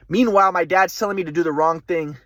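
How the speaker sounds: usual speaking voice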